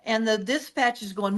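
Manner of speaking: normal talking voice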